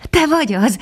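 high, glad surprise; light up at seeing an old friend; the dog delighted to meet the bat; joyful. Acted. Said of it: "örvendezett meg Füstös".

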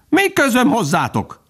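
high, offhand bird voice; dismissive kingfisher. A narrator's reading. Kingfisher brushing off the lost ducklings, high dismissive call. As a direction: toss it off as you fly past. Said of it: "kiáltotta a jégmadár".

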